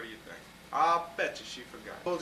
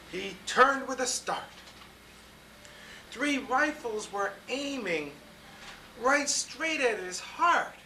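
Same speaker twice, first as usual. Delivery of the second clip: put-on lively read-aloud to children; storybook reading; 5 semitones higher, 4 dB louder than usual. A narrator's reading. Grown-up reading a picture book to kids, lively storytelling.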